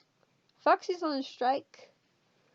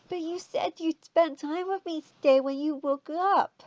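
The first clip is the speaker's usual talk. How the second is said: put-on higher, louder childish whine; whiny kid voice; 4 semitones higher, 6 dB louder than usual. A narrator's reading. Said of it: "Was that better".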